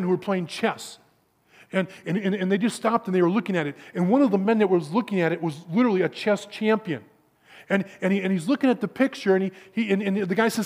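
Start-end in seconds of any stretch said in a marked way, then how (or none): none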